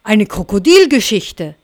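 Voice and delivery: loud eager crocodile call. Acted. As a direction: call it out across the room. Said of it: "Das Krokodil rief". (laut rufendes Krokodil)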